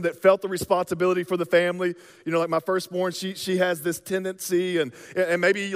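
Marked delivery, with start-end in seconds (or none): none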